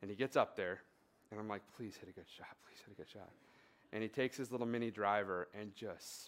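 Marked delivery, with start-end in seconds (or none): 1.8-3.3 under-breath plea. Retold or imitated. Dad silently hoping for good shot.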